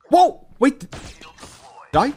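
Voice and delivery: high, loud real yelp of surprise; startled whoa. Real, not acted. Streamer caught off guard mid-game, startled whoa. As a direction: burst out, pitch leaping up.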